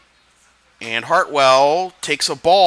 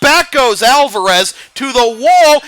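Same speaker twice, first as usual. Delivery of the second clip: louder, higher, building to the big moment; home run call; 9 semitones higher, 6 dB louder than usual. Acted. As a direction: ride the ball to the wall.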